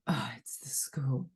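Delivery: anxious whisper. Retold or imitated. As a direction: whisper it with dread. Dread at school calling.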